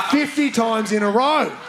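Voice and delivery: usual speaking voice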